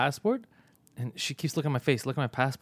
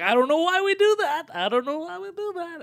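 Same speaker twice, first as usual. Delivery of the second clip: squeaky put-on voice, endings held long; helpless mimicry; 3 dB louder than usual. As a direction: imitate a helpless excuse in a high squeal. Defenseless woman quoted in helpless whine.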